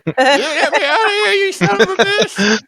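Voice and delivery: high, loud hammed-up screaming; mock-outraged high-pitched yell; frantic yelling gnome. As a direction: shriek like a furious little captive, playing it up. Hamster-ball gnome yelling at players.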